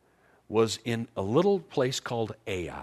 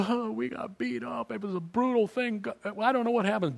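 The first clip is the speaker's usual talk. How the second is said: much higher, whining defeated soldiers; whiny soldiers; 11 semitones higher than usual. Retold or imitated. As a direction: whimper the excuse like beaten men. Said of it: "They come back to Joshua".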